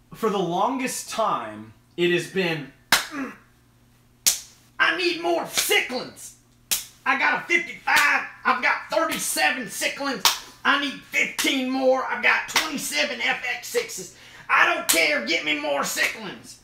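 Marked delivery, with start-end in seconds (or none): 4.8-16.6 high, excited mock-demanding voice; hammed-up greedy hobbyist, pitched way up; frantic fish collector. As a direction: insist on more in a silly high voice. Parody of greedy fish keepers.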